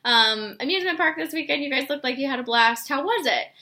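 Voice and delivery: put-on high, bright excited girlfriend voice; bubbly friendly message. Shown as a demonstration. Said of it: "Things like that are gonna get people to respond".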